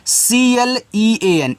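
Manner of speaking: each letter drawn out; spelling out. Shown as a demonstration. Teacher spells word by letters.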